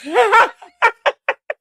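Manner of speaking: high, loud burst of laughter; real helpless laughter; big laugh. Real, not acted. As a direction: crack up at the comment. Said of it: "I'm crying".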